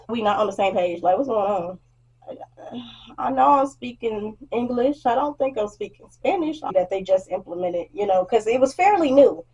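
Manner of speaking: usual speaking voice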